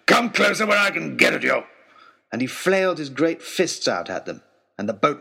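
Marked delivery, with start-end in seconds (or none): 0.1-1.6 loud, high, aggressive yell; shouting. Acted. Baronet yelling at geese to come closer, loud shout. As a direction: taunt the birds at full voice. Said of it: "he was shouting".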